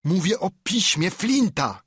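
high loud furious cry; angry shout. A narrator's reading. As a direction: snap back in fury. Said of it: "krzyknął". (wściekły okrzyk ślepca)

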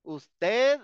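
ordinary talk

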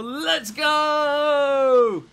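loud, high, drawn-out cheer; triumphant shout. Real, not acted. Player cheering a point against Riptide, triumphant shout. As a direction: roar it out in victory.

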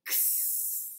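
an unvoiced "X" like ks, hissing; ks sound. Shown as a demonstration. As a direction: crackle then hiss like a snake. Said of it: "ready for that?".

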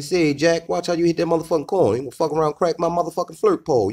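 high, fast mock old-school hustler voice; hammed-up high voice warning Jack about the car; old-timer car guy.

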